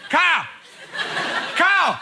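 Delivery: mock calls building in volume; summoning the girlfriend, mock impatient; calling out. Calling out for Carol.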